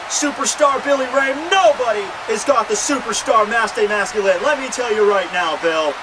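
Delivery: high, excited ringside play-by-play parody; wrestling commentator. Acted. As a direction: call the match to your co-commentator, worked up.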